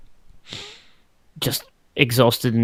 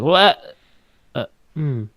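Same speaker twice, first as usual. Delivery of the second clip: flustered double take; 2 semitones higher, slower than usual. Acted. Player as Zig stops mid-answer, a flustered double take. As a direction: start startled, then falter into a hum. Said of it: "do that double take".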